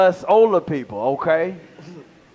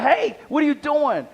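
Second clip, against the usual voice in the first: put-on high shrill-free kid voice calling out; angry gamer kid; 6 semitones higher than usual. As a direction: play the annoyed gamer kid, higher. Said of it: "they was like".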